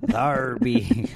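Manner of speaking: laughing through a pirate line. Pretend. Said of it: "pirate voice".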